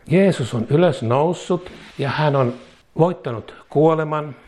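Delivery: usual speaking voice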